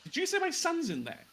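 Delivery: high, fast, alarmed parent voice. Acted. A voice asking after their son through the portal, alarmed. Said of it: "you hear a voice saying".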